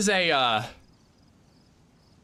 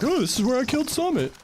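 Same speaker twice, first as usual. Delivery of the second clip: excited; 11 semitones higher, faster than usual. Retold or imitated. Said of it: "so I was like".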